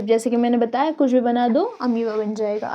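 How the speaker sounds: ordinary talk, nothing marked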